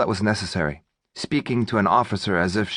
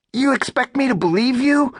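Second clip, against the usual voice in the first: squeaky robber voice; 4 dB louder than usual. Acted.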